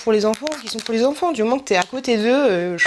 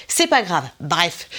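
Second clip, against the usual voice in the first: normal talking voice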